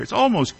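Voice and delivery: "almost" picked out with a lift in pitch; stress on almost. Real, not acted. Preacher qualifying a remark about kids' fights, stressing "almost". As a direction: put the weight on "almost". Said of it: "I have to emphasize the word almost".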